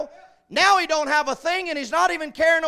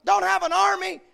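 usual speaking voice

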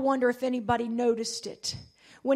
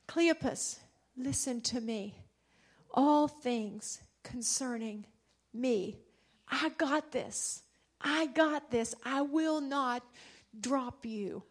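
soft, slow reassurance, much softer; tender Jesus voice; 3 semitones lower, 8 dB quieter, slower than usual. Acted. Jesus comforting Cleopas, soft and slow. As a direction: hush down and comfort them.

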